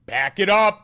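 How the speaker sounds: high loud shout of the words. Retold or imitated. Shouting a phrase at chat, full yell. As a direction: yell it out sharply. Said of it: "When did I say pack it up?".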